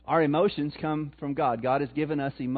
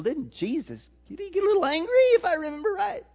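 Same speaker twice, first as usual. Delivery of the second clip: high-pitched, playful feigned uncertainty; mock-innocent question; 12 semitones higher, faster than usual. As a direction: ask it in a high voice, as if unsure. Rhetorical question teasing the congregation.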